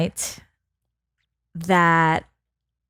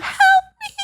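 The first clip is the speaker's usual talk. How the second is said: high, pleading cry for help; damsel in distress. Acted. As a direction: squeal it like a helpless damsel. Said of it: "here's my damsel in distress voice".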